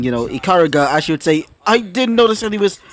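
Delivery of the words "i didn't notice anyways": high, blushing protest; embarrassed denial. A narrator's reading. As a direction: deny it in a higher, embarrassed voice. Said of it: "a now blushing".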